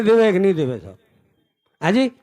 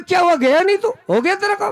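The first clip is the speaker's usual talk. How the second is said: questioning call; 9 semitones higher than usual. Acted. Prophet questions returning man.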